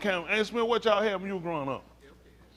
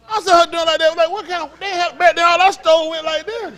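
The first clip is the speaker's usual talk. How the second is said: excited; 9 semitones higher, 7 dB louder than usual. Real, not acted. Excited demonstration.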